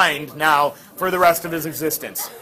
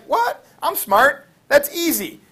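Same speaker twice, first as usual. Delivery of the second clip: high, mock-surprised show-off voice; cocky Oedipus; 8 semitones higher, 4 dB louder than usual. Oedipus scoffing at the Sphinx's riddle, high and mocking. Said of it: "He was like".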